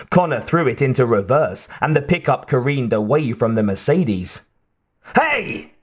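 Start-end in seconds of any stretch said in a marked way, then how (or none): 5.1-5.7 high-pitched angry hail; shout. A narrator's reading. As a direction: shout high after a fleeing driver. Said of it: "the driver shouted".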